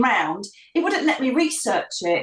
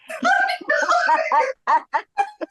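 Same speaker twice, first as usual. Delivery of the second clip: laughing. Real, not acted. Host teases guest as judgmental, high laughter.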